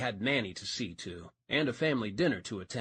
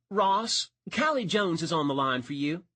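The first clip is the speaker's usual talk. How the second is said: raised, higher call across an office; calling across the room; 5 semitones higher, 4 dB louder than usual. Acted. Mildred calls across the sheriff's office to Ross, raised call. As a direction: call out to a man at a far desk. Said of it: "Mildred called across the sheriff's office".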